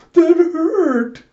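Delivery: loud, very high wail; hammed-up high cry of pain; pained protest. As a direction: yelp it in cartoonish hurt. Chaos smacked, bloody nose.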